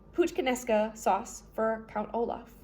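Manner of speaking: plain reading voice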